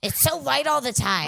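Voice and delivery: high mock shout about the light; mock complaining shout. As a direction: yell it in fake despair.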